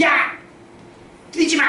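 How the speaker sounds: high-pitched mock scolding shout; scolding yell. Church elders catching kids running on the stairs, scolding. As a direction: call out sharply to stop a child.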